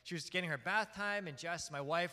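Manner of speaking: ordinary talk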